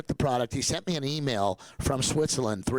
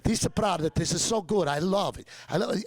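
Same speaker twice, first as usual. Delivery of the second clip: high-pitched, hammed-up Italian accent; 8 semitones higher than usual. Italian researchers raving about the toothpaste, in a high Italian accent. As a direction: imitate gushing Italians with an accent, pitched well up. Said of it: "it gets more in the flavor".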